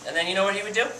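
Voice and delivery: usual speaking voice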